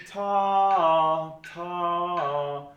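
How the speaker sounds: two sung ta syllables, high and loud; slurred ta ta. Shown as a demonstration. Band teacher singing the slur to students, high and loud. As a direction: demonstrate the slur on ta ta. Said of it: "there's two different slurs".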